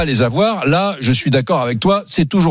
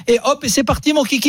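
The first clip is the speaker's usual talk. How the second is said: excited; 9 semitones higher than usual. Real, not acted. Triumphant punchline of own story.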